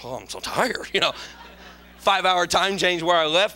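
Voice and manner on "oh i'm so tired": low soft sleepy moan; a touch of exhausted whine; mock weary groan. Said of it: "I was like".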